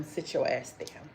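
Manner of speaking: a quiet firm sit down; hushed scolding. Retold or imitated. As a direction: settle them down under your breath. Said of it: "They be telling them".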